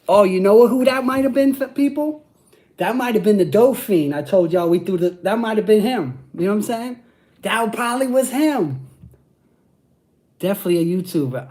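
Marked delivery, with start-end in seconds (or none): 0.1-8.9 high, loud, sudden realization; excited realization. Real, not acted. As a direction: it hits him, louder and higher. Realizes who the heckler was.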